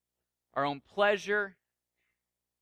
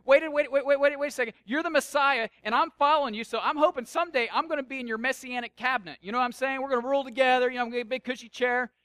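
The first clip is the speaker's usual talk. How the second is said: higher, louder, rushing objection; flustered protest; 5 semitones higher, 5 dB louder, faster than usual. Acted. Peter pleading his ambitions to Jesus, flustered and insistent. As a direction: break in urgently and talk him out of it. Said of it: "So Peter's like".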